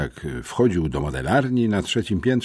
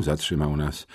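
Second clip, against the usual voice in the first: ordinary delivery, nothing marked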